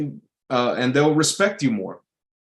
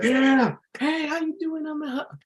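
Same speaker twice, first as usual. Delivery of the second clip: high, sleazy mock voice; creepy speaker greeting. Event speaker greeting the couple, high hammed-up sleazy voice. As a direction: play the creep going in for a hug. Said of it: "one of those speakers like".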